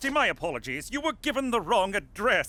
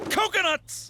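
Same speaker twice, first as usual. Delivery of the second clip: exclaimed; 9 semitones higher than usual. Acted. Aide stressing Tonga's advantage to Jimmy, exclaiming. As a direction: burst out with Coconuts! in alarm. Repeats word louder.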